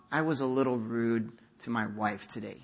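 low, soft, understated admission; mild, downplayed confession. Churchgoer softening a confession of his faults, mild. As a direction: quietly play down your own fault. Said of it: "we kind of, uh, mild it".

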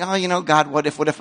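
casual hedging. Retold or imitated. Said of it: "not just saying".

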